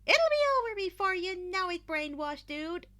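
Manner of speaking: high, loud, bright-voiced girl reassuring; cheerful reassurance. A narrator's reading. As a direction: Hatsume cheers up a nervous teammate, loud and high. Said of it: "Hatsume reassured".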